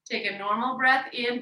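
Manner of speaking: usual speaking voice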